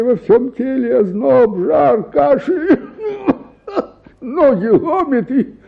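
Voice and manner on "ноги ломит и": a laugh through the complaint; rueful laugh over your own misery. Acted. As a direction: chuckle while you list the aches.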